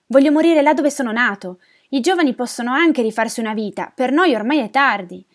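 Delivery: loud, high, anguished complaint; lamenting old man. Acted. Old refugee longing for his birthplace, lamenting loudly. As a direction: pour out the grievance loudly. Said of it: "si lamentava un altro vecchio".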